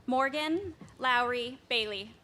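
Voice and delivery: projecting names across the hall; firm carrying classroom voice; teacher voice. Real, not acted. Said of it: "I have to use my teacher voice".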